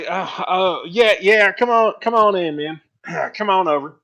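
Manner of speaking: high, loud, eager welcome; dwarf inviting travellers into his carriage; hearty dwarf. Acted. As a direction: wave them in like an old friend. Dwarf welcomes them aboard.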